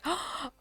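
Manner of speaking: a sharp, high gasp; quick high intake of breath; mock gasp of surprise. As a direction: gasp as if suddenly recognizing someone. Ex spots her at rave.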